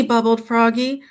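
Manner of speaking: ordinary delivery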